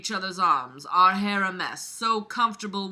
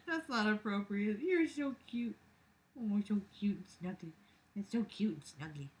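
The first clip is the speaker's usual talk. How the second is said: cooing at a pet; 9 dB quieter than usual. Real, not acted. Owner cooing softly at her cute snuggly pet.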